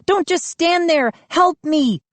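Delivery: high angry plea; desperate shout. A narrator's reading. Mindy scolding the boys while carried off, desperate. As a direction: demand they help, outraged.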